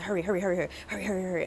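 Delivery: rapid-fire repeated urging; imitated rushing. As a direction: imitate someone hustling you along. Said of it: "he kept rushing me".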